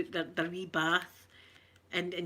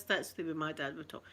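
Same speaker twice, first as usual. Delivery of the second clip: normal talking voice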